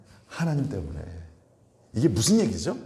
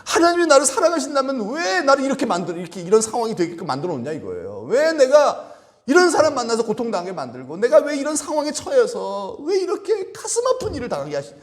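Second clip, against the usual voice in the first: higher, louder aggrieved complaint; pleading, higher and louder, half acted; mock complaint; 11 semitones higher, 4 dB louder than usual. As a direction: press God with indignant why-questions. Believer's doubting complaint voiced.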